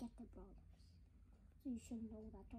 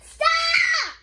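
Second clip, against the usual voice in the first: annoyed shout; 13 semitones higher, 6 dB louder than usual. Real, not acted. Sister annoyed by sneezes.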